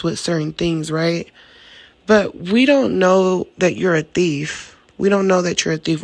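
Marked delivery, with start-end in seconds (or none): 2.1-4.7 slow, pointed mock innocence; drawn out with a knowing pause on don't; slow deliberate. A sly aside to the thief, said slowly and pointed. 5.0-6.0 fast tossed-off repeat; quick throwaway. Retold or imitated. The same jab at the thief, rushed out fast. Mock innocent quote, said twice.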